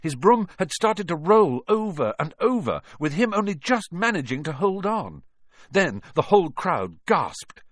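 tense narration. A narrator's reading. Narration building tension.

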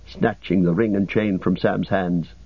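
ordinary delivery

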